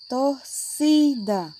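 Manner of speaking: "Torcida" very slowly, syllable by syllable, leaning on "ci"; stress on CI. Shown as a demonstration. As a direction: stretch out "Torcida" to show the strong middle syllable. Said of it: "É a penúltima aí".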